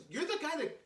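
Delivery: high-pitched incredulous question; imitated amazement. Producer realising who his visitor is, imitated high disbelief. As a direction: marvel at who this turns out to be. Amazed man recognizing him.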